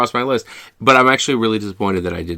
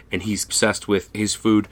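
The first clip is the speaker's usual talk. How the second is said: ordinary talk, nothing marked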